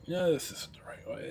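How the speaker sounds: softly whispered to himself while fiddling; whispered aside. Real, not acted. Vlogger whispering to himself as he fumbles the setup, hushed. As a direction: drop to a private whisper. Fiddling with camera, muttering to self.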